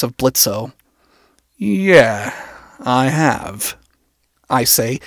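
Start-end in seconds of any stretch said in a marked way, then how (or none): 1.6-3.8 slightly strained admission; somewhat strained. A narrator's reading. Anon admitting he has met Blitzo, somewhat strained. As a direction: answer with a little strain. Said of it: "voice somewhat strained".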